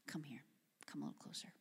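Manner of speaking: soft, whispered call to come closer; hushed beckoning. Acted. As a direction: draw them in with a whisper. Elijah gathers the crowd close.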